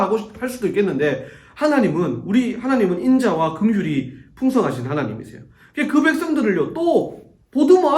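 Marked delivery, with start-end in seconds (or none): none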